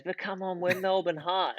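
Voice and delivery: excited plea. Retold or imitated. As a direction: beg the bouncer with a lifted pitch. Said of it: "he goes".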